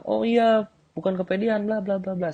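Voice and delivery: girl explaining she's not overconfident, high mock protest; mock girl defending herself. Shown as a demonstration. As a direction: squeal out the excuse.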